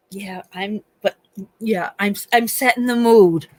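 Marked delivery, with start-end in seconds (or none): none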